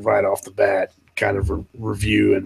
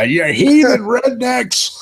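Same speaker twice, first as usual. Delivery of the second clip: mocking critics; 11 semitones higher, 5 dB louder, slower than usual. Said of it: "I hear people now".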